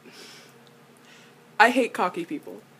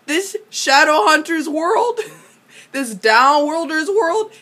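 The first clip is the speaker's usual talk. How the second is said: ordinary delivery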